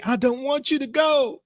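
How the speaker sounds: high-pitched mock plea from a woman; imitated crying girl. Crying girl begs the young man to stay, mock-pleading. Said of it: "She started crying".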